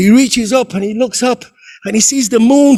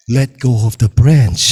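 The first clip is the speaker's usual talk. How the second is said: voice of God; 13 semitones lower than usual. Acted. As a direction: speak calm and low as God. God commands the climber.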